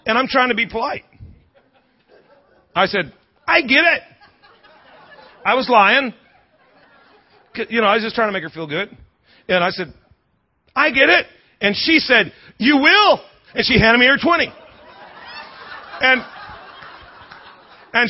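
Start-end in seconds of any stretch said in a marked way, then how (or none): 3.5-4.0 high, pitched-up mock holler; raised-voice agreement. Fan politely agreeing with screaming stranger, raised voice. 10.7-11.3 high, pitched-up mock holler; raised-voice agreement. Fan politely agreeing with screaming stranger, raised voice. 12.6-13.2 imitated high, loud shout; hollered command. Wife ordering a stranger to fetch beer, hollering.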